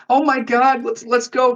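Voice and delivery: high-pitched retold fright; high, pleading mock panic; imitated panic. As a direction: imitate a panicked friend, voice shooting way up. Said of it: "Completely demeanor changed".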